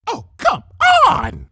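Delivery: exasperated shout at the mirror; shouting at her reflection. Acted. Rarity yells at her reflection, exasperated shout. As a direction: yell it at your own reflection in disbelief. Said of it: "she shouted at her reflection".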